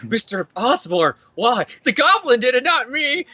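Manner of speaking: hammed-up protest that rises as it goes; Peter. Hero confronts the unmasked villain, mock-dismayed pleading. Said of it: "and then Peter's like".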